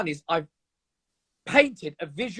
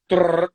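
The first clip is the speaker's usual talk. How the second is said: machine gun noise; 4 dB louder than usual. Retold or imitated. Imitating machine gun fire.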